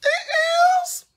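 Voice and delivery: high yelp of alarm; sudden shriek. Real, not acted. Sudden outburst while doing nails.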